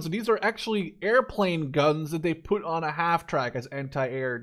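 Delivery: usual speaking voice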